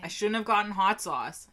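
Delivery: normal talking voice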